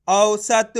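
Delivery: औसत joined up, slow and loud; blended syllables. Shown as a demonstration. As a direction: join औ-स-त into one stretched, emphatic औसत. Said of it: "तो मिलके बन जाएगा".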